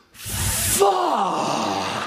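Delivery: high, drawn-out shout of amazement; stunned shout. Retold or imitated. Sick teenager suddenly seeing his visitor walk in, stunned shout. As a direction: imitate a boy blown away by his visitor. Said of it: "His eyes go wide".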